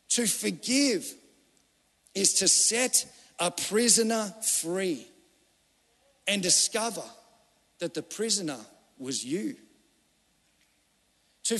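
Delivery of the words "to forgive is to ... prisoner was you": a solemn, drawn-out saying; slow, weighty delivery. Acted. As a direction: let "To forgive is to set a prisoner free" sink in phrase by phrase. Repeated quote, first slow take.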